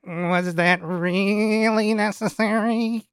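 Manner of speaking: high, slow nasal whine; nasally voice. Acted. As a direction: whine it through the nose. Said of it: "you hear a very nasally voice say".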